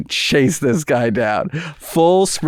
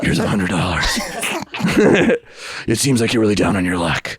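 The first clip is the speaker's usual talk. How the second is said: low, put-on superhero voice; deep mock-heroic delivery; Batman; 5 semitones lower than usual. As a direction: play the caped hero, pitched well down. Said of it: "after he gets to the Joker, he's like".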